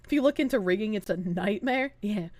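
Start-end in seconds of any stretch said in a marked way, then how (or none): none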